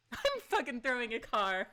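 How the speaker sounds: real delighted laugh through the words. Real, not acted. Streamer laughs, thrilled her character throws a car. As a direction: giggle while saying it.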